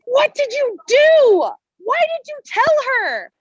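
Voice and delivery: high, loud panicked outburst, slow; a mother shrieking at the child who spilled the secret; frantic demand. Retold or imitated. Distraught mother demands why the child told, in a high frantic cry. Upset mom confronts child.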